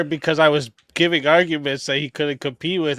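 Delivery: usual speaking voice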